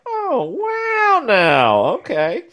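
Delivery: high-pitched mock wow, then settling; retold surprised reaction. Recounting the dating-app find to co-hosts, mock surprise. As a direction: act out a startled little oh wow. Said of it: "so I'm like".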